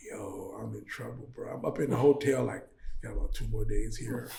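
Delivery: low, hushed, dejected self-talk; subdued worry. Retold or imitated. Stranded traveller up in the hotel, subdued worry. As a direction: mutter it low and soft, stuck with no money.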